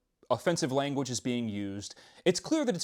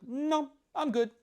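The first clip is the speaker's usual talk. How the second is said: mock polite no; slower than usual. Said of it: "And he's like".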